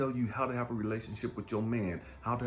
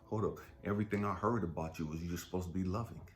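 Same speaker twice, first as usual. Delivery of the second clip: ordinary delivery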